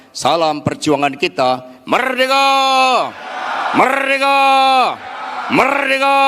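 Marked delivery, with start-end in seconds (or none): 1.9-6.2 high, ringing shouted rally cry. Real, not acted. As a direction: call it out to rouse the crowd. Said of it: "kita pekikkan salam nasional kita".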